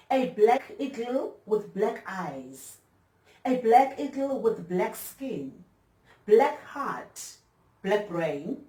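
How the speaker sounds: slow, high, emphatic declaimed verse, easing down at the end; measured, ringing spoken-word delivery; poem recitation. Acted. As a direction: declaim each phrase with weight and pauses.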